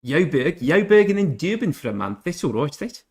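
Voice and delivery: put-on a clumsy stab at a South African accent; hammed-up South African accent that drifts; failed South African accent. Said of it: "Sorry, I don't know what accent that was".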